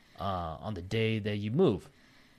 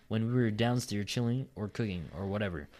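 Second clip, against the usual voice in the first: normal talking voice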